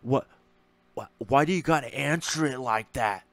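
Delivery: playing dumb, confused whine; mock dumb voice. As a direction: imitate a clueless guy. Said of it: "play stupid".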